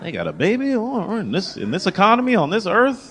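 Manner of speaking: high-pitched incredulous marveling; mock amazement. Comic marveling at parents in this economy, mock amazement. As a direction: climb high, astounded at such wealth. Mock awe at people having babies.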